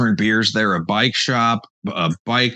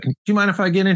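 polite request; 10 semitones higher, faster than usual. Retold or imitated. Retold polite request to the lady.

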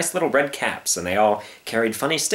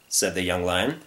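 plain reading voice

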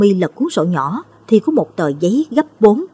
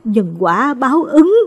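slow and drawn out, each word weighed; a mother speaking slowly to her daughter-in-law; slow, grave; 6 semitones higher, slower than usual. A narrator's reading. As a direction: say it slowly like a heavy verdict. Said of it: "chậm rãi nói".

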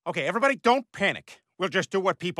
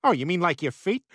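ordinary delivery

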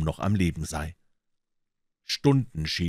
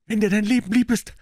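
high, loud panicked shout; shouted warning; 6 dB louder than usual. Acted. Tom yelling at Huck to flee, loud panicked shout. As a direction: yell it as if running for your life. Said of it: "schrie er".

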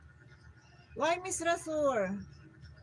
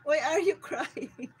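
laughing question; 4 semitones higher, 7 dB louder, slower than usual. Real, not acted. Laughing while teasing host.

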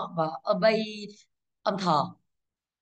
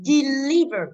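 short di, long punched LI in deliver; stress on second syllable; 3 semitones higher, 5 dB louder, much slower than usual. Shown as a demonstration. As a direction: deliver slowed, with a strong LI. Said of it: "trọng âm sẽ rơi vào sau nó".

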